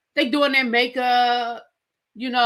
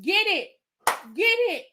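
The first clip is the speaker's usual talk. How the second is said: high hype-man egging on; a light party chant; mock cheer; 6 semitones higher than usual. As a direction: cheer them on, pitched up. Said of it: "the chef was like".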